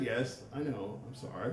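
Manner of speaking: soft, low sheepish reply; meek apology. Retold or imitated. Husband apologizing to screaming wife, meek. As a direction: apologize small and quietly.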